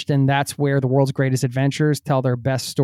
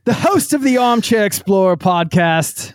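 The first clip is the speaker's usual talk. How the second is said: raised, hammed-up British announcer; mock British accent; 6 semitones higher, 3 dB louder than usual. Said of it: "I'll get off the accent thing".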